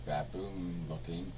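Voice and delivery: hushed, low and unbothered; calm. Retold or imitated. Imitating the YouTuber's old calm videos, calm and low. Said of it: "always kinda calm, and you're just kinda like".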